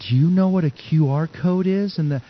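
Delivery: high, put-on waitress voice. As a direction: spell it out for someone hard of hearing. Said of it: "a little louder 'cause she thought".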